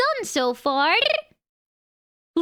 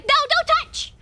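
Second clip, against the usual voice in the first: high, mock-frantic warning off; playacted possessive protest; fire guarder; 6 semitones higher, faster than usual. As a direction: shoo them from your fire in a pitched-up voice. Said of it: "she's like".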